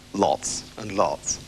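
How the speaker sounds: low, slow, soft fake fondness; drawn-out oily sweetness; sickly sweet. Septimus faking affection to his brother.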